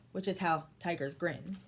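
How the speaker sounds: ordinary delivery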